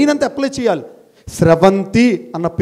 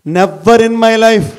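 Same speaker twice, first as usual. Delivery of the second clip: never in my life, louder and slow; ringing vow; 3 semitones higher, 6 dB louder, much slower than usual. Real, not acted. Swearing to students he never gave up, louder and slow. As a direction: swear it out loud, slowly.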